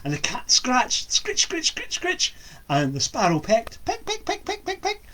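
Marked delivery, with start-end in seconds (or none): 1.1-2.3 high squeaky scritch scritch; high scratching sound. A narrator's reading. Storyteller voicing the cat's scratching, high. 3.9-5.0 high little peck peck peck; high pecking sound. A narrator's reading. Storyteller voicing the sparrow's pecking, high.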